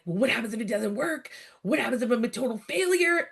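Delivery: mock-anxious spiral of what-ifs; retold panic. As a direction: replay your own panicked worry, hurried and high. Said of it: "literally having a panic attack".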